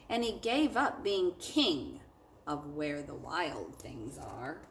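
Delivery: expressive picture-book read-aloud for a class; lively storybook narration; story reading to children. A narrator's reading. Teacher reads picture book aloud.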